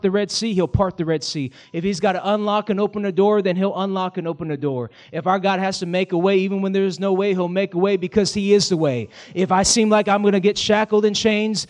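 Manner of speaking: loud, high, rising preacher's cry; preaching at full force. Acted. Pastor crying out to the church that God makes a way, preaching at full force. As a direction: drive it home to the congregation.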